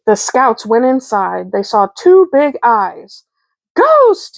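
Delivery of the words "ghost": a very high frightened yell of "Ghost!". Acted. Sister yelling in fright at the eyes in the dark house, a high yell. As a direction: shriek it at the sight of two big eyes. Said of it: "yelled Sister".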